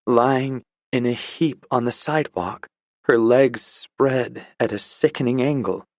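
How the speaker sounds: ordinary delivery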